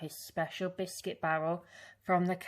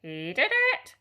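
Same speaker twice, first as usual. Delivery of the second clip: high, loud bird squawk; parrot squawk; 7 semitones higher, 7 dB louder than usual. A narrator's reading. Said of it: "squawked the silly bird".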